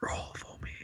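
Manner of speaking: breathy coaxing whisper. Acted. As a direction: hush it out, barely voiced. Coaxing a roar.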